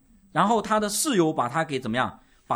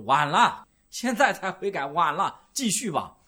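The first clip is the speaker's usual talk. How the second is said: mock stern God; 3 semitones higher, 5 dB louder, slower than usual. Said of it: "上帝说".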